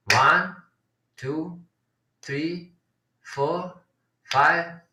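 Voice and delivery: spaced-out count to eight, twice over; beat-by-beat count. Shown as a demonstration. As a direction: leave a clear pause after every number. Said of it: "the way they count this".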